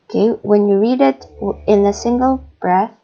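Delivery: ordinary talk, nothing marked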